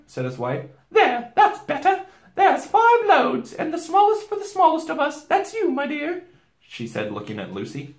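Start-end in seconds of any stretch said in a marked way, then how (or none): 0.9-6.2 Missus Beaver. A narrator's reading. Beaver wife handing out loads, high and motherly. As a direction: share out jobs in a high motherly voice.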